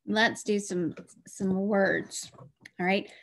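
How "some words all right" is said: ordinary talk, nothing marked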